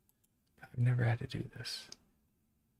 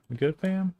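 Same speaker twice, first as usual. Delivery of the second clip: high mock question; 9 semitones higher, 4 dB louder than usual. Talking to the computer.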